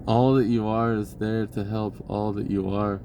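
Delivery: ordinary talk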